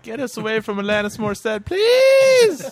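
high, shrill, pleading shout; desperate plea. Pretend. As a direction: cry out to be rescued. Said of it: "They're like".

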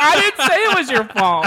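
high, loud indignant retort; exasperated high-pitched denial; loud protest. Retold or imitated. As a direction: yell it defensively over laughter. Retells her protest to date.

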